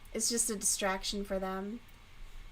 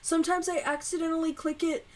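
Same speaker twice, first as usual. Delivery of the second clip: higher, quick mock excuse; imitating brother; 6 semitones higher, faster than usual. Imitating her brother excusing himself, a higher mock voice. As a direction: play the brother making excuses. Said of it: "He's like".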